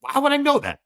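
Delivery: hammed-up incredulous reply; incredulous club operator. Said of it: "He's like".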